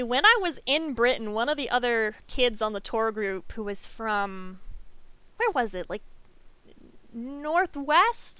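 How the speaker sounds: ordinary talk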